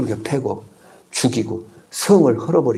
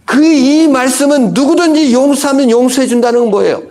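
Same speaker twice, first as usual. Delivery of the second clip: ordinary talk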